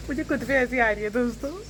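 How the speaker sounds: giggling through the words; laughing at herself. Real, not acted. Vlogger laughing at herself for doing makeup at dawn, giggling. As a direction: laugh at your own silliness.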